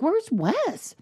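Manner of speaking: high, retold query about a child; searching question. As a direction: ask it high, checking on someone. Mother asks after missing son.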